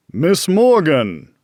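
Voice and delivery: deep-voiced greeting. A narrator's reading. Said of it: "Mac's deep voice greeted Andy".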